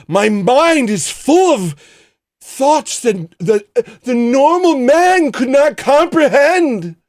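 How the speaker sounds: high, loud, slow grandiose proclamation; hammed-up lofty artist, reaching for the divine; pompous artist. Streamer mocks himself as a misunderstood genius, high and grand.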